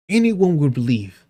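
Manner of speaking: usual speaking voice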